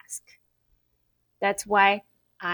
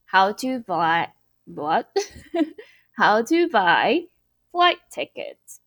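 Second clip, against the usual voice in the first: ordinary delivery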